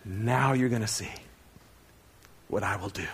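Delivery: low, softened divine promise; voice of God. Acted. God reassuring Moses when he returns, solemn and low. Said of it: "God says to him".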